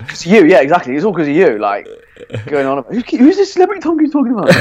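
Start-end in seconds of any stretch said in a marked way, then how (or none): 2.9-4.5 much higher, quick mimicking voice; mock curious listener. Podcaster mimics listeners asking who the celebrity is, mock curious. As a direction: put on a high, hurried questioning voice.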